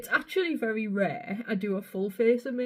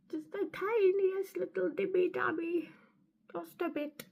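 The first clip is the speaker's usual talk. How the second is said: high, soft little squeak; tiny playful voice; 10 semitones higher, 5 dB quieter than usual. Pretend. Vlogger dabbing precious powder, tiny playful voice. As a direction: make the voice as tiny as the amount.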